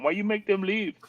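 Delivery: mock his whining, high; a hint of crying, mocked high; high whiny mock crying. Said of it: "He's crying".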